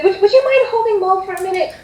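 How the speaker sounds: high, hurried hostess voice; hostess asking favour. Acted. Mother asks guest to hold toddler, hurried and high.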